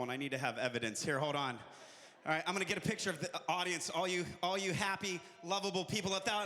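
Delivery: ordinary delivery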